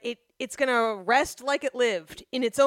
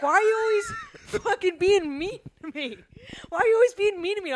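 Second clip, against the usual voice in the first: whiny friends; 6 semitones higher, faster than usual. Retold or imitated. Hurt friend group mock-whining at the sarcastic friend. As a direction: whine it like hurt friends, rushing the repeat. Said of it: "They're like".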